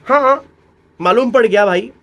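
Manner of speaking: playful excited answer; mock eureka. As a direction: pretend students got it.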